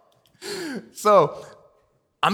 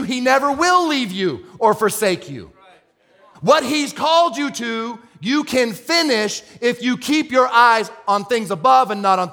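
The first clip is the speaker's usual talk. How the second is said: rising sermon build, pitched up and driving; preaching; 8 semitones higher, 5 dB louder than usual. Acted. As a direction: preach it to the room with fire.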